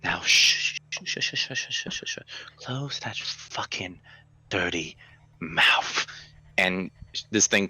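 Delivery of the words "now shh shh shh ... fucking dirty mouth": softer, hissed shushing; menacing whisper. Acted. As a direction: hush the victim like a predator. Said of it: "this thing looks at you and goes".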